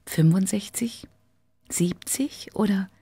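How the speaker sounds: whispering the numbers to herself; whispered guess. Acted. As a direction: guess quietly under your breath. Paola guesses the woman's age.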